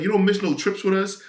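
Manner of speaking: mock exclaim it like an envious friend; excited retold quote. Friend telling him he never misses trips, high and loud.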